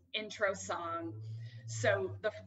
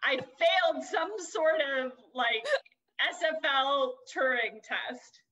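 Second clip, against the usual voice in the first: mock outrage; 6 semitones higher, 6 dB louder than usual. Real, not acted. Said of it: "I'm officially angry".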